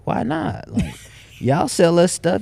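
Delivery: high-pitched mock schoolkid protest; indignant protest. A former schoolkid arguing back about selling candy, indignant protest. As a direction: argue back in a high, retold complaint.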